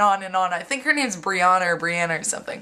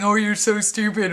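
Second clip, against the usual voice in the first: mocking taunt. Bully making fun of a girl in the locker room, mocking. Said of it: "started making fun of her".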